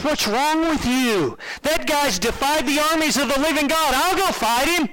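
put-on a kid outraged at the cowards; indignant boy. David voiced, indignant at cowards.

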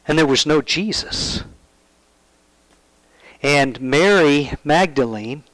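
ordinary delivery

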